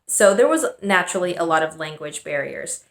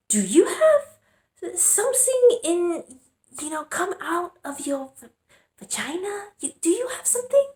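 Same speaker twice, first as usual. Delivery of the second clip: imitating her mom; 11 semitones higher than usual. Mom nervously questioning her seven-year-old, high mock voice. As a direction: put on her anxious Chinese mom asking, pitched way up. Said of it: "She's like".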